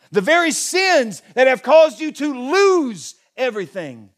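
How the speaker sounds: loud, high, impassioned preaching; rising passionate sermon climax; full-force preaching. Acted. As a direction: pour it out over the congregation.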